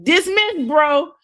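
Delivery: very high, loud retort; mock indignant outburst. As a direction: imitate an outraged squawk at a friend. Acted quote from quarrel at table.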